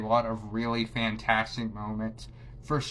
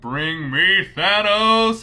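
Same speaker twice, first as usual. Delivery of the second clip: louder, higher, slow booming roar; Thor battle cry; 6 semitones higher, 9 dB louder, much slower than usual. Retold or imitated. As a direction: bellow it like a god arriving for battle. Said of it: "And he's like".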